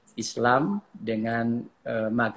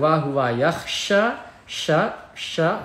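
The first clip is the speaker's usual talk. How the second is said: slow, raised tartil chant; Quran recitation; 5 semitones higher, 5 dB louder, much slower than usual. Shown as a demonstration. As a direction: chant it with the last vowel held.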